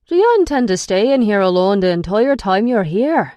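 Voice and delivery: put-on angry man scolding a hiding girl. A narrator's reading. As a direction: confront her angrily. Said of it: "Brennis asks me angrily".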